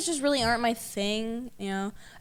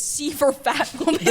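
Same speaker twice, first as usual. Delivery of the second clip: laughing; 4 semitones higher than usual. Retold or imitated. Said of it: "the quote that she sent me".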